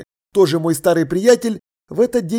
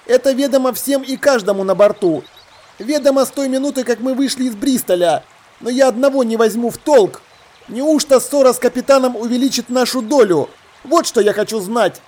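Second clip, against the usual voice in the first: higher-pitched sailor pressing his objection; doubting sailor; 6 semitones higher than usual. Acted. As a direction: challenge the leader, voice pitched up.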